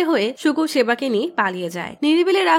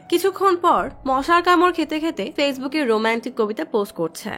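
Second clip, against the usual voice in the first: ordinary delivery, nothing marked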